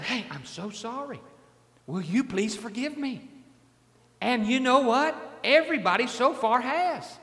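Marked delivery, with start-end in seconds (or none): 0.0-3.3 quiet and sincere, much softer; softly asking forgiveness from someone he offended; softer humble apology. Retold or imitated. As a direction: say sorry gently, looking them in the eye. Apologising face to face.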